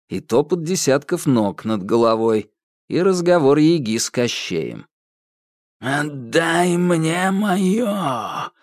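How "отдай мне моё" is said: very slow, menacing voice; grim threat. A narrator's reading. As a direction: threaten darkly. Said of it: "угрюмый угрожающий голос". (угрюмый угрожающий голос)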